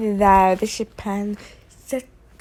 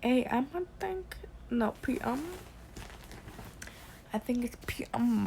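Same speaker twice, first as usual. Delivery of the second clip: whispered; 9 dB quieter than usual. Real, not acted. Unsure about the time.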